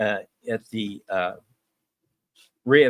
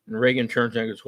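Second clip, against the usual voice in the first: ordinary delivery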